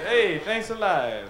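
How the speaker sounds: put-on father thanking the kids for cheap cologne, half-hearted; mock thanks. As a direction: drag out a fake thank-you. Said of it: "He says".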